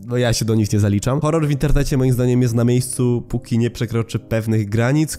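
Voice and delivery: usual speaking voice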